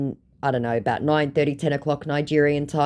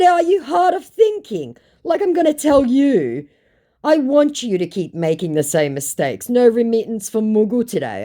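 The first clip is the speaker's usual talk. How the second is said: higher and louder, scornful; mocking taunt; 10 semitones higher, 5 dB louder than usual. Taunting the scammer she won't help, scornful. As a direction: sneer at him.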